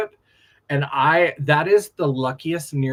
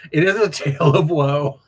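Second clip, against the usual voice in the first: laughing; 2 semitones higher, 5 dB louder than usual. Real, not acted. Laughing at a friend's story.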